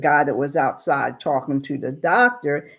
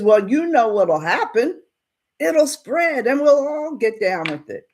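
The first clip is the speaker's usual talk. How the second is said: higher-pitched agitated warning; acted upset; 7 semitones higher than usual. Townsman warns storekeeper that fever will spread, acted upset. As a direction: warn her with rising agitation, pitched up. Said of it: "Now Bass is getting upset".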